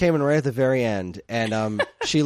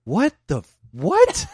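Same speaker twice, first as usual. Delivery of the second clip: retold confusion; 6 semitones higher, 4 dB louder, slower than usual. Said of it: "he started talking like".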